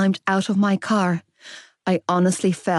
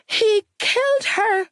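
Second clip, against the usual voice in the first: Ollie screaming; 13 semitones higher than usual. A narrator's reading. Little boy in the hallway after violence at home, screaming. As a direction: cry out as a horrified little boy. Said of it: "Ollie was screaming from the hallway".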